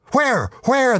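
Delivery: very high, startled yelps; alarmed cry. Acted. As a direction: cry out in theatrical panic. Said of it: "the devil cried".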